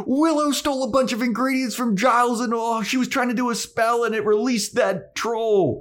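very high tattletale outburst; Anya. Retold or imitated. Ratting out Willow to Buffy, high tattling voice. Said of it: "rats out Willow so fast".

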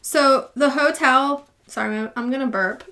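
ordinary delivery

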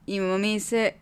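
ordinary talk

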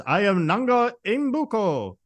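high, slow imitation of Eddie Murphy; slow, high put-on introduction; put-on character voice. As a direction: imitate a comic exchange student introducing himself. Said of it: "he's putting on this voice".

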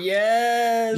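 high, loud delighted yell; excited cheer. Real, not acted. Podcaster cheering the joke slogan, loud excited yes. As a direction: cheer it like a rally crowd.

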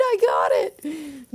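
high excited squeal of a cheer; cheering happily; little cheer. Retold or imitated. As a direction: celebrate like a kid who just won. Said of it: "she does a little cheer".

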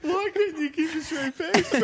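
high, loud, real laughter through the words; laughing hard. Real, not acted. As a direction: barely get it out for laughing. Cracking up at a friend.

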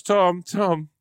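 high excited calling of Tom; imitating the wife's excited call. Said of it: "she sounds quite excited".

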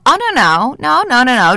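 high, rapid-fire protest; firm refusal. Acted. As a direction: cut them off with high-pitched noes. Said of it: "It takes a pretty strong person".

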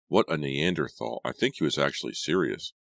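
ordinary delivery, nothing marked

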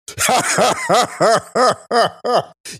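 loud delighted laugh; big high laugh. Real, not acted. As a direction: burst of high-pitched laughter. Laughing at risexual joke.

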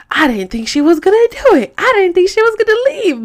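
high-pitched mimicking of a stunned husband; retold disbelief. Husband retold, stunned that she left.

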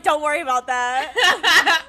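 put-on loud, laughing New York accent. New Yorker in Texas brushes it off, New York accent. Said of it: "New York accent comes out so hard".

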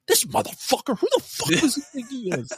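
high-pitched indignant rant; re-enacted righteous outrage. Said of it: "you become so indignant".

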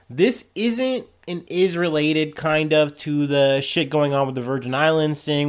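ordinary talk

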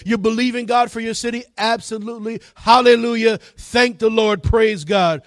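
high-pitched, insistent church exhortation; full-force preaching. Real, not acted. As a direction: pile up the praises like a revival preacher.

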